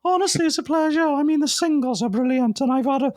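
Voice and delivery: throat squeezed shut with nerves, voice jumped way up; nerves-strangled voice. Retold or imitated. Nervous fan talks to his hero, voice choked high. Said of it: "my voice went, my throat started to constrict with nerves".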